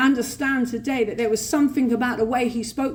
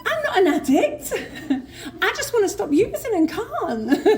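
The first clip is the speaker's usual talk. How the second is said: very high, laughing self-parody of indignation; mock offended denial; 10 semitones higher than usual. Addict insisting to helpline she's no addict, mock offended. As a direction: protest absurdly high, sending yourself up. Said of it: "I was offended".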